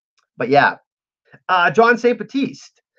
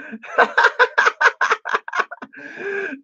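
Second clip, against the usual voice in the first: long, high cackle; helpless laugh. Real, not acted. Streamer realising he's the only man on panel, laughing.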